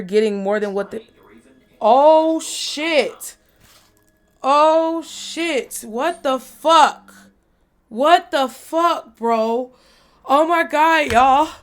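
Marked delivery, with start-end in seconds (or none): 1.8-11.5 startled, high and loud; real jump-scare exclamations; scared. Real, not acted. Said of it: "That scared the shit out of me".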